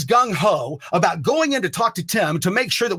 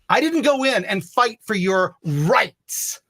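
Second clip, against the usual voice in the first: very slow, higher, each word spelled out to the coworkers; slow pointed retort; 5 semitones higher, much slower than usual. Retold or imitated. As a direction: lay it down word by word to the crew. Retold telling off coworkers.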